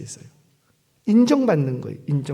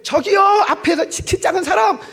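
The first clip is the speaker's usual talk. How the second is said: high-pitched hailing shout; playful loud call to stop someone ahead; 12 semitones higher, 4 dB louder than usual. Acted. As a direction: act out shouting after a stranger. Acts hailing a stranger in the market.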